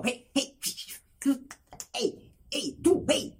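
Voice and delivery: short mock hails; retold calling out. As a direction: imitate a neighbor calling someone like a dog. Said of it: "que de arriba me gritan". (imitando llamados bruscos del vecindario)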